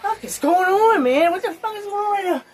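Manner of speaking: high, fast panicked yelling; panicked disbelief. Retold or imitated. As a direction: freak out in shock. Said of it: "And I was like".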